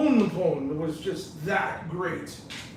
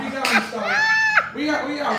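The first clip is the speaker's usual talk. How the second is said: playful shout; 6 semitones higher than usual. Real, not acted. Host shooing guest off jokingly.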